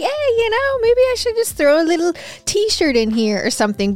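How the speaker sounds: high-pitched mock inner thought; inner voice quote. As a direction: pitch way up, playing her own impulse-shopping self. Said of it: "I'm also like".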